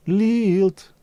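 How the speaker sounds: high, drawn-out mock advert call; advert catchphrase. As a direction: call it out like an old drinks jingle. Imitating the old Lilt advert.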